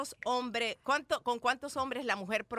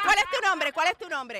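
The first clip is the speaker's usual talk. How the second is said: indignant demand; 5 semitones higher, 8 dB louder than usual. Real, not acted. Defending Amalia, confronts the insulter.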